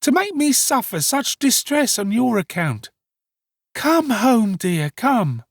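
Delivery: put-on high, drawn-out wailing young woman; hysterical exclamations. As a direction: lament loudly, as if overcome at finding him. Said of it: "With these incoherent exclamations".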